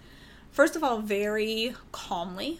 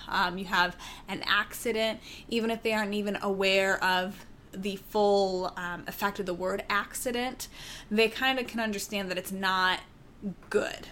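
ordinary delivery

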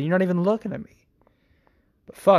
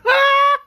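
high, loud burst of laughter; 7 dB louder than usual. Real, not acted. Podcaster reacting to a listener's song, high laughing outburst. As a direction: crack up in a high-pitched laugh.

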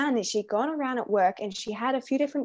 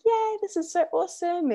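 excited; 8 semitones higher than usual. Retold or imitated. Happy people greeting the carolers.

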